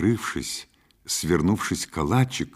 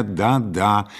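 ordinary delivery, nothing marked

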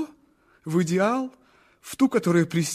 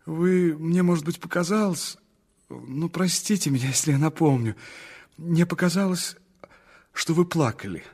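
usual speaking voice

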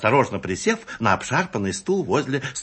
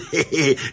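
high, jovial chuckle; conspiratorial giggle; 5 semitones higher than usual. Acted. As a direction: giggle knowingly. Customer chuckles at the arrangement.

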